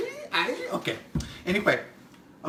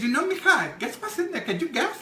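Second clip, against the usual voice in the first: higher, coy "guess what's in there?"; teasing own retold voice; 10 semitones higher than usual. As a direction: tease her sweetly before the reveal. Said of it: "and I said".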